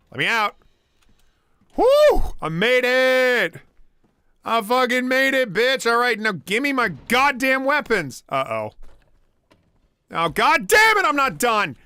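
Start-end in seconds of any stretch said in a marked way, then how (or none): 1.8-3.6 excited shout. Real, not acted. Escaping the monster, triumphant yell. 4.4-8.2 excited yelling. Real, not acted. Triumphant yelling after escape. 10.1-11.8 angry shout. Real, not acted. Caught again by the monster.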